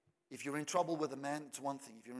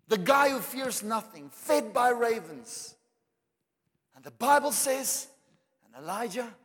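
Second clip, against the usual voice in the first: higher and louder, building fervor; preaching at full force; 8 semitones higher, 9 dB louder than usual. Acted. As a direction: preach it up, rising to the peak. Preacher at climax.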